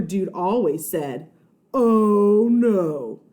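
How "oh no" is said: loud superhero cry; dramatic hero voice. Acted. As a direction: boom it like a hero. Super Dude catchphrase.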